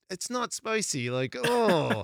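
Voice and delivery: high, slow mock exasperation; exasperated retort. Dad arguing with picky daughter, hammed-up exasperation. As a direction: groan back in high-pitched protest.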